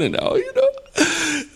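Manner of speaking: very high-pitched hammed-up wail; mock weeping. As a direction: act out a breaking-down, tearful squeal. Acts out Mary weeping at tomb.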